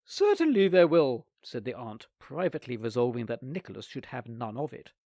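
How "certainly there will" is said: loud, quick reassurance; false promise. A narrator's reading. Aunt lying to Nicholas, loud eager promise. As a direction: promise it without meaning it.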